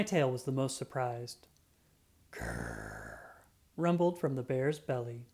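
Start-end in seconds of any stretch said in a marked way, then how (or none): 2.3-3.2 very low, soft rumbling growl. Acted. Narrator voicing the mystery sound, a low growl. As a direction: rumble it deep like a bear's belly.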